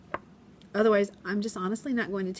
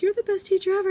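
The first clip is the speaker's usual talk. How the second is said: put-on high, louder child's praise; student praising his teacher; sweet kid gushing; 12 semitones higher, 5 dB louder, faster than usual. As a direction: gush like a beaming first grader. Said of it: "the next day was like".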